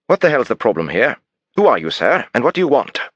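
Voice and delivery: put-on English diplomat demanding answers, English accent; clipped English accent. Suspect challenging the detective, affronted English accent. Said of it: "he asked in a clipped English accent".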